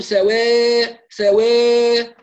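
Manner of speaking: سواء with the aa leaning toward e, drawn long; wrong imala. Shown as a demonstration. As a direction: tilt the alif of سواء toward e. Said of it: "لا نقول".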